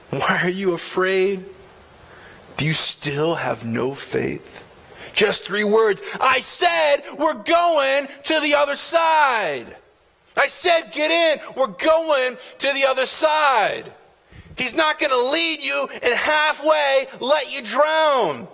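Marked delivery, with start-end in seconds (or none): 0.1-4.6 low, soft and slow, lovingly asking; a low, soft and slow question; gentle Jesus. Acted. 6.2-13.9 higher, slow, emphatic insistence; preacher voicing Jesus, higher and slow; insistent command.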